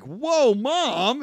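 high, loud embarrassed "whoa, mom"; shocked protest. Acted. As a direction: cry out, mortified by mom. Daughter embarrassed by her mom.

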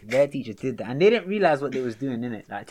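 usual speaking voice

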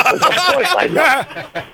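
slow, high, laughing through the words. Retold or imitated. Retelling the boss's reply as a laughing punchline. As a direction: let the laugh carry the punchline.

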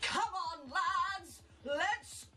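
high, drawn-out battle cry; big rallying shout. Acted. Player rallying the team for a morale boost, big shout. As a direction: belt it out to rouse the troops. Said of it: "Such a big voice".

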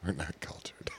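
whispered, almost breathless; whispering. Real, not acted. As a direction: say it under your breath. Whispered aside.

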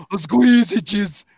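slow, drawn out "squeezy cheese"; milk "squeezy" for laughs; hammed-up emphasis. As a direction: overdo the stress on "squeezy". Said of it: "say it all with the emphasis".

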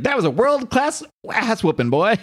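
high-pitched hollering character voice; Clyde. Acted. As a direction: crow it in a put-on voice, high. Acts Clyde's quote, high and far.

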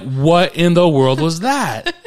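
loud, slow, stunned disbelief; slow-motion astonished outburst; mock shock. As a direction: draw it out in comic alarm. Retold shock at hard landing.

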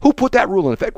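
high, heated mock argument; lightly voiced coach ranting; other coach arguing. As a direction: argue a made-up rule. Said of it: "the other coach comes out".

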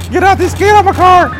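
high, loud panicked scream; shrieking high and loud in panic. Real, not acted. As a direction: yell the passengers out of the car. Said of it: "Oh, I'm screaming".

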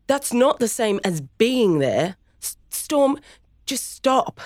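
yelling. Acted. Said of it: "Kaya yelled".

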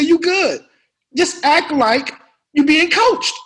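loud, high, impatient pep talk; Sam Jackson. Retold or imitated. Star urging a nervous rookie through the scene, impatient. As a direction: imitate a star pushing a rookie onward. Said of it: "He said".

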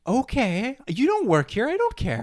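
high-pitched, exaggerated brush-off; dismissive imitation. Leonard answering heated Sam, high mock-dismissive voice.

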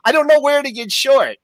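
high, frantic panicking trader; panicked trader. Retold or imitated. Trader panicking as the market crashes, high frantic voice. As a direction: blurt it out in a high-pitched panic. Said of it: "and he's like".

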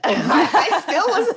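high laugh breaking through the words; giggling through it, pitched high. Real, not acted. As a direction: laugh at your own nerve. Laughing at having no money.

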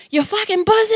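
high-pitched cussing out in traffic; playfully acted angry outburst; mock road rage. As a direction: hurl it at another driver, hammed-up. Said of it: "I'll be mad".

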